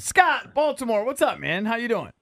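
high, bright call-in welcome; radio host greeting. Shown as a demonstration. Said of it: "Say, uh".

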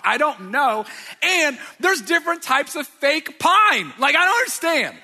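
high, loud mock exasperation; exasperated overwhelm. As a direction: build into a comic, overwhelmed outcry. Acting out overwhelm at tree choices.

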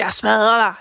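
normal talking voice

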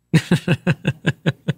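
short, rapid run of chuckles; chuckling. Real, not acted. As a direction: chuckle at your own joke. Laughs at own expert claim.

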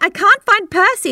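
panicked wife. Retold or imitated. Telling her husband she lost the cat, mock panic. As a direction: replay your own panic, a little hammed-up. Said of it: "I was like".

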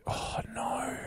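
breathy dismayed whisper. Real, not acted.

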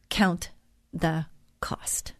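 word by word, dropping soft; measured warning with pauses, fading at the end; weighty warning. Real, not acted. Host warns listeners about decisions, weighty and deliberate.

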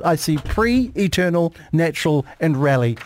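"pre", "eternal", "natural", "rally" as separate words; word split apart. Shown as a demonstration. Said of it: "I see three words in my head".

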